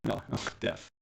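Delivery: ordinary talk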